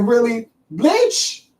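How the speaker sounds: high, rising one-word question; high-pitched incredulous echo; mock disbelief. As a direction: act taken aback, voice shooting up. Acts out offended person's reaction.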